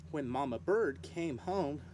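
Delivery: usual speaking voice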